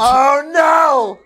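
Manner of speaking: high, stretched cry of dismay; horrified exclamation. Real, not acted. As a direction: wail it in disbelief. Listener dismayed at the story.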